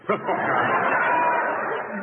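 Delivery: a hearty burst of laughter at his own pun; laughing at own joke. Real, not acted.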